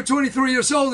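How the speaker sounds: ordinary delivery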